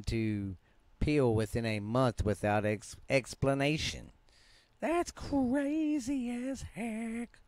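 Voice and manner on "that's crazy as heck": high falsetto, slow; squeaky falsetto. Acted. Reacting again, comic put-on voice.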